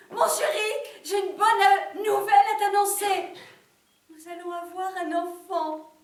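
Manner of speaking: high, loud, elated news-breaking; excited announcement. Acted. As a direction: burst out with big news for your husband. Wife announces news to husband. (annonce exaltée, aiguë et très forte)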